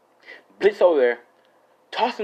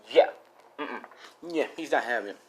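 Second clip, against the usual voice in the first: usual speaking voice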